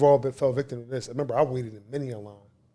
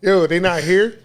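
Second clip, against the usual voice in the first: higher, louder mock complaint; retelling an upset demand at the counter; 6 semitones higher, 8 dB louder, slower than usual. At the shoe store clerk, a higher, louder upset demand. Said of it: "Like, I used to get upset".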